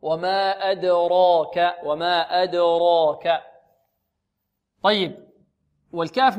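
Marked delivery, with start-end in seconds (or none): 0.0-3.4 وما أدراك slow, with a full heavy ra. Shown as a demonstration. Teacher shows the heavy ra in أدراك to the class. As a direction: draw out وما أدراك and weight the ra. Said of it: "سبقت بحرف مفخم وهو الراء".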